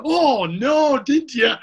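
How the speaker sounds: sarcastic oh no, did you; fake sympathy. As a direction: pretend to be sorry for them. Mock sympathy to rival fans.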